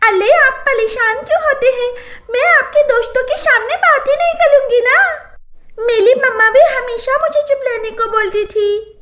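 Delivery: put-on high lisping baby talk with giggles; laughing lisping voice. Bride laughing as she comforts her husband, high and lisping. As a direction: chirp through a giggle. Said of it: "पायल हंसते हुए कहती है".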